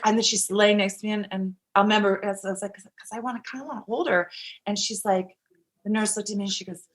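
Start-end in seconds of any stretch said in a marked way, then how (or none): 2.7-4.3 soft, small confession; timid asking. Retold or imitated. New mother asking the nurse to hold baby, timid. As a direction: murmur it shyly.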